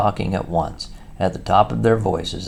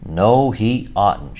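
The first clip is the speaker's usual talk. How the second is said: Mole shouting no, slow and louder; 4 semitones higher, 6 dB louder, much slower than usual. A narrator's reading. Mole interrupts Rat, loud, slow and emphatic. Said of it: "shouted the Mole".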